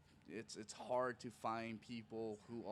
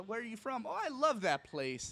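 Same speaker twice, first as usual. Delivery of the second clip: friendly crowd work; 10 semitones higher, 3 dB louder than usual. Retold or imitated. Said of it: "Even my crowd work is".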